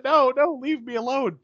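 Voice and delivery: high-pitched imitation of a victim's scream; mock screaming. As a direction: wail it up high without real volume. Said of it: "and she's screaming".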